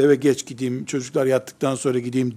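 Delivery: a weary, quiet excuse; reluctant mumble. Retold or imitated. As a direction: mumble it like a tired man dodging home. Tired husband avoiding home voiced.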